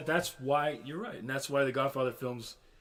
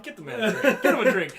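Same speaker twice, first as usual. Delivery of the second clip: laughing imitation; 8 semitones higher, 10 dB louder than usual. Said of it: "He straight tells the guy".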